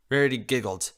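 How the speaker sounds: ordinary delivery, nothing marked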